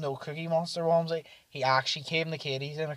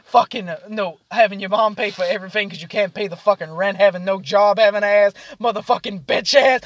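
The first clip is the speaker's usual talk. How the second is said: loud, raised-pitch string of insults; imitated roast; 5 semitones higher, 8 dB louder than usual. Drunk friends roasting each other at the table, mock-aggressive. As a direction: reel off mock insults with force. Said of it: "start roasting each other".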